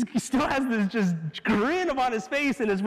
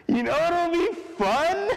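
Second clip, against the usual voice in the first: higher, gleeful question; playful owner; 8 semitones higher than usual. Acted. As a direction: savor the idea with delight.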